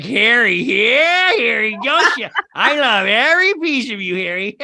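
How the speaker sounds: high, loud gushing lover; swooning lover. Retold or imitated. Wife adoring her hairy husband, high, loud and gushing. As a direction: gush over him like a smitten wife. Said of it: "And she's like".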